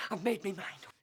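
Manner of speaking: low, soft grim statement; quiet resolve. Acted. Man telling his friend his mind is made up, quietly. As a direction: murmur it to yourself, decided. Quiet then shouted repeat.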